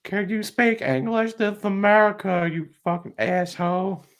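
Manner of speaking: high, slow entitled Karen rant. Acted. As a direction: demand like an outraged Karen. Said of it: "a Karen here".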